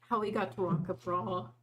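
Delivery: ordinary talk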